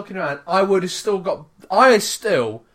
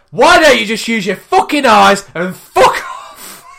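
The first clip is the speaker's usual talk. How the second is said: loud, high, slow furious yell; full-volume road-rage outburst, re-enacted; retold raging; 4 semitones higher, 11 dB louder, slower than usual. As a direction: rage at a stranger, stretching it out. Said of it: "just raging at this woman".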